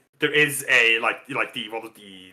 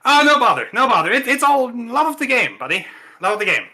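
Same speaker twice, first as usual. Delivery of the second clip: higher, louder breezy modesty; higher, louder cheerful champ; the champ, modest; 8 semitones higher, 4 dB louder than usual. Acted. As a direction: wave off the compliment happily. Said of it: "he's like".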